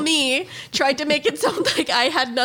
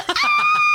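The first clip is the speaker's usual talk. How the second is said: high mock shriek; mock scream. Sister imitating brother screaming through the window. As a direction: shriek like someone terrified. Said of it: "you're still in there like".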